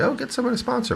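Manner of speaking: high mocking singsong. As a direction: mimic the people who always say it. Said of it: "people always say".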